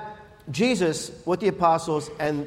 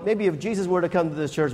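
ordinary talk

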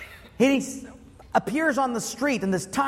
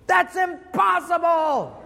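high, slow shout of disbelief; incredulous shout; 9 semitones higher, 4 dB louder, slower than usual. Retold or imitated. Time traveler hearing the brother will fly, shouting high and slow. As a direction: bellow it like it's absurd.

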